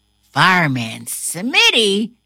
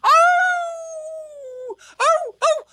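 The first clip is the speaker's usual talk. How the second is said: high, long howling oh; a dog howling in alarm; much slower than usual. A narrator's reading. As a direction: howl it like a dog. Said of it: "The firehouse dog howls".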